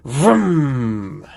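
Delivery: a droning hovercraft vroom; playful engine noise for a hovercraft. A narrator's reading. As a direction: buzz it like a motor zooming by. Hovercraft on land and water.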